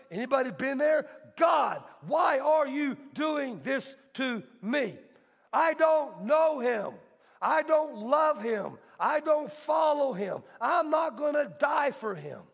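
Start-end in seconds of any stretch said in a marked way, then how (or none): 1.4-12.4 raised, higher shouting at God; angry cursing. Acted. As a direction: rail at God in fury. Peter voiced cursing God.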